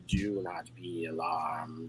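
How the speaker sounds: low, slow alien voice; slow, low and otherworldly. Acted. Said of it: "this strange sort of alien type voice".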